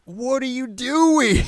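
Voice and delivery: high, drawn-out mock yell; shocked shout. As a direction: cry out in exaggerated alarm, stretching the words. Startled kids as she jumps down.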